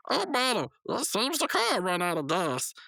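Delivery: high-pitched cartoon character; high, slow dotty voice; Pops. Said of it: "And Pop said".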